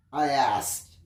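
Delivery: usual speaking voice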